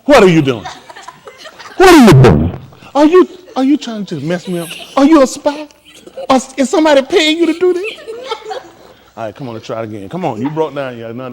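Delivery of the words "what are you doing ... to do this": loud, high, half-laughing mock suspicion; mock accusation. Real, not acted. Coach jokingly accuses student of being a spy, mock-suspicious and loud. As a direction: accuse him in fun of sabotage.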